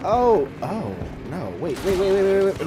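high, loud panicked pleading; frantic, rising panic. Real, not acted. Panics as mobs approach.

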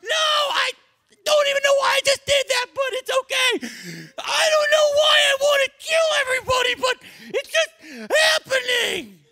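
high shouted laugh-cry; crazed, laughing apology; very high shrieking, laughing and crying; frantic killer. Acted. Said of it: "He's like".

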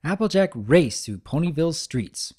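ordinary delivery

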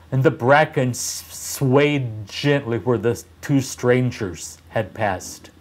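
ordinary delivery, nothing marked

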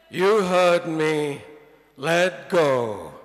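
low, loud, unhurried command; God's voice. Acted. God telling the man on the branch, booming and low. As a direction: boom it down calmly from above. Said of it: "You know".

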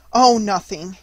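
ordinary delivery

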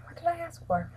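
ordinary delivery

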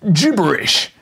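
loud, scornful shout; cried. A narrator's reading. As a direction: spit the word out. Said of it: "he cried".

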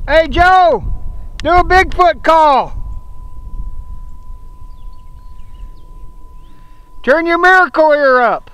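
shouting across the woods; calling out. Real, not acted. Calling out to Joe far off.

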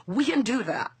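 ordinary talk, nothing marked

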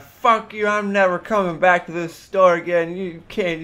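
irate yelling. Retold or imitated. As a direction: scream it as you storm off. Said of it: "she became irate, yelling profanities at me".

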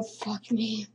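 usual speaking voice

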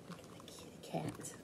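hushed whisper. Acted. Whispering to the children about the kitty cat. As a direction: breathe it like a secret.

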